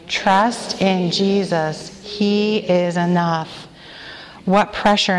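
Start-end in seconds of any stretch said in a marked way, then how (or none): 0.1-3.7 slow, weighty reading of the letter's phrase; slow recited phrase. Shown as a demonstration. Leader introducing the acrostic letter to the women, slow and deliberate. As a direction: lay each word down slowly for the room to echo. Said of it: "let's say that together".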